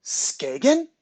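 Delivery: startled, high, loud question; sudden high-pitched outburst; blurted. A narrator's reading. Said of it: "Jite blurted".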